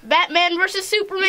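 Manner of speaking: excited shout. Real, not acted. Announcing the movie title.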